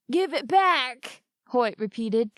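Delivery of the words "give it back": slow, higher, forced firmness; steel in his voice. A narrator's reading. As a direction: try to sound tough while dazed. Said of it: "trying hard to get some steel in his voice".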